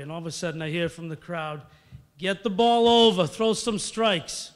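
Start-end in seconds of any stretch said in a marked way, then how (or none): 2.2-4.5 loud mock shout from the stands; mother yelling. Mom shouting at her son on the mound, loud. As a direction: yell it across the field like an annoyed mom. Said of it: "from a woman".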